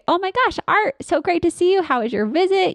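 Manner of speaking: a big, high, delighted hello; excited greeting. Shown as a demonstration. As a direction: greet the patient like an old friend. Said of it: "So I'd say".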